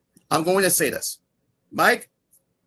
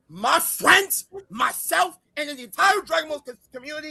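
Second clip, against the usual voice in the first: high-pitched indignant shouting; angry outburst; 8 semitones higher than usual. Real, not acted. Streamer defending friends and community from a rival, furious. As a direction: hammer out each wronged party, outraged. Furious listing of who was insulted.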